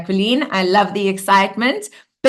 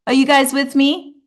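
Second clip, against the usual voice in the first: ordinary talk, nothing marked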